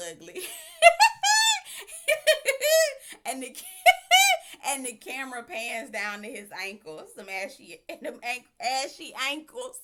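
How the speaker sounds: high cackling laughter breaking the words; can barely get it out for laughing; laughing hard. Real, not acted. Recapping the ugly shoes scene, breaking into high laughter.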